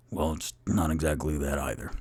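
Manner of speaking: low put-on dwarf voice; Bonnie as Onyx. Shapeshifted Bonnie corrects Rock, low dwarf voice. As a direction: drop the pitch for a borrowed dwarf voice. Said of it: "Bonnie turns and goes".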